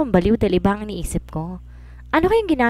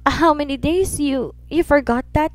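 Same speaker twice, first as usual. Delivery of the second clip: put-on child voice; 7 semitones higher, slower than usual. Son voiced, high.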